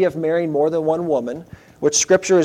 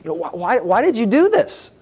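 mock-frustrated, voice rising higher at the end; stammering, higher-pitched bafflement; 3 semitones higher than usual. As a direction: act out Jacob's exasperated protest. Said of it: "he's pretty frustrated with Laban".

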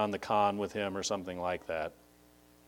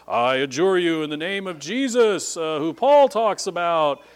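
loud, raised-pitch magic formula; mock incantation; 6 semitones higher, 7 dB louder than usual. Amateur exorcist confronts a demon-possessed person, loud mock incantation. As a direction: proclaim it like a would-be exorcist. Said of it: "pull out your little magic formula".

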